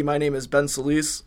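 usual speaking voice

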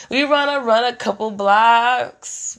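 put-on slow, drawn-out plea to come get the kids; slow pleading call. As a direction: stretch every word, begging dad. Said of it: "I'm calling daddies".

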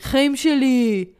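mock charmer. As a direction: croon it like a smooth Israeli flirt. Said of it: "He's like".

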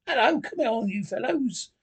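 high, hearty call to friends; cheerful greeting. A narrator's reading. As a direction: hail them cheerily. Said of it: "he cried cheerfully".

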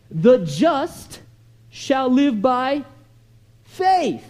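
slow, weighty declamation with punched key words; measured, emphatic pulpit recitation; preacher reciting scripture. Acted. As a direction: declare the verse, pausing before each big word.

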